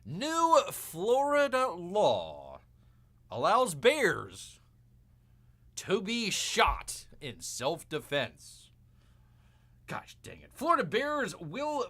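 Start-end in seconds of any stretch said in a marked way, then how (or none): 0.1-8.7 very slow, deliberate news-reading voice; slow headline read. Shown as a demonstration. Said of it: "Bear reads". 9.9-10.5 whispered grumble. Real, not acted. Grumbling at the bad news.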